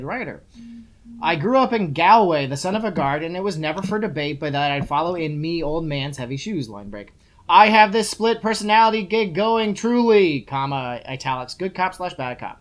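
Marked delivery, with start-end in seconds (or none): 1.2-12.6 reading book aloud. Acted. Host quotes award-nominated writer to co-host, emphatic read-aloud. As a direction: read it out with a big lift, drop for punctuation.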